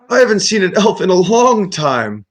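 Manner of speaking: put-on high-pitched, delighted old wizard; loopy friendly wizard. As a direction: marvel at a rare visitor as a dotty old man. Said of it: "a little loopy friendly".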